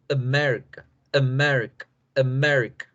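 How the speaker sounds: "America" with reduced first A and strong MER; English pronunciation drill. Shown as a demonstration. Teacher modelling for students to repeat, English pronunciation drill. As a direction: repeat "America" as uh-MER-i-cuh, falling each time. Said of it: "dice la palabra America".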